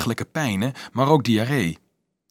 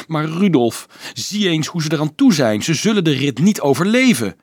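higher, louder pleading; urgent, raised and desperate appeal; desperate cry; 5 semitones higher, 5 dB louder than usual. A narrator's reading. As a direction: plead in despair. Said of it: "riep hij wanhopig".

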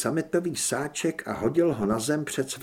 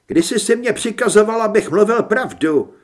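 raised-voice retort, interrupting; sharp interruption; 7 semitones higher, 8 dB louder than usual. A narrator's reading. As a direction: cut in high and loud. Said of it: "přerušil ho Mikuláš". (důrazné skočení do řeči)

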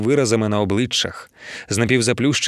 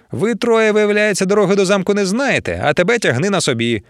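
high-pitched, heated complaint; exasperated scolding; 9 semitones higher than usual. A narrator's reading. Man threatening to leave the three, exasperated scolding. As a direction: tell them off, fed up.

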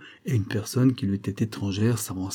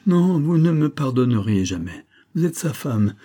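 raised, emphatic protest; crying out; 5 semitones higher, 5 dB louder than usual. A narrator's reading. Sick woman tells the wife she'd never forgive, crying out. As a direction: protest loudly, voice lifted. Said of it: "s'écria la femme".

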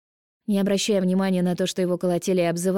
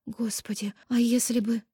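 frightened; 5 semitones higher, slower than usual. A narrator's reading. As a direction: dread of what might have been. Tearful, cut off mid-word.

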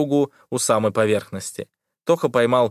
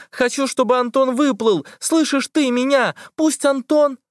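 rising, ever higher frantic shout; desperate scream; 14 semitones higher than usual. A narrator's reading. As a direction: yell at it until cut off. Liza keeps screaming, cut off. (отчаянный крик, всё выше)

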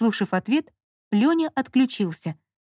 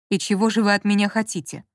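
ordinary delivery, nothing marked

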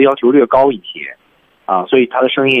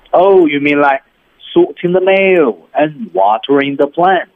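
slow Shrek delivery; 3 semitones higher, slower than usual. Shown as a demonstration. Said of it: "语速就变成".

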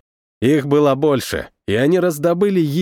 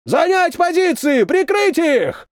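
drill sergeant; 14 semitones higher than usual. A narrator's reading. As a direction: roar the orders across the field. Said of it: "заорал старшина".

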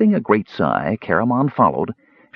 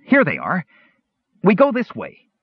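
high eager kender voice; 10 semitones higher than usual. A narrator's reading.

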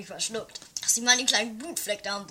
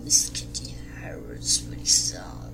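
unvoiced whispered name. Commissioner secretly naming the suspect, whispered.

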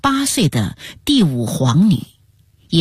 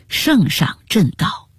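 usual speaking voice

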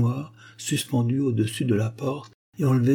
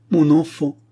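« mon enfant » crié plus haut et plus fort, en sanglots; le deuxième appel éclate en pleurs; cri sanglotant; 6 semitones higher, 5 dB louder than usual. Acted. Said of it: "et s'écria avec des sanglots". (mère en deuil crie vers son enfant mort, en sanglots)

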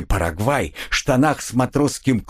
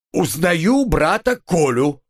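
slow, high theatrical cry; exclamation of recognition; 6 semitones higher, slower than usual. A narrator's reading. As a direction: hail a long-lost brother, stretched and hammed-up. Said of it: "воскликнул прозревший Вася". (протяжный театральный возглас узнавания)

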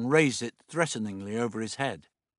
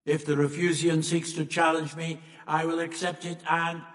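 higher, chant-like alien monotone; monoid, defiant; 6 semitones higher than usual. A narrator's reading. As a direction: state defiance on one level note. Plays the monoid.

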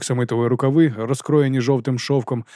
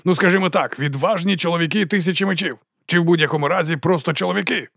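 raised, declaiming speech voice; ringing oration; 5 semitones higher than usual. A narrator's reading. Captain playfully corrects his hail to the men, ringing oratory. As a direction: address the assembled troops grandly. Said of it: "Його голос дзвенів".

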